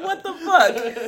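slow, high, stunned and laughing; mock shock. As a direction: act floored by the insult. Retold shocked reaction.

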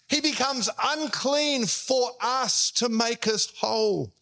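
ordinary delivery

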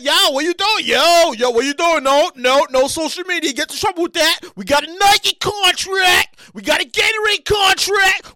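loud, slow firm warnings; mock handler scolding. Imagined minder scolding the star, loud. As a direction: stop him like a minder. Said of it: "the person that".

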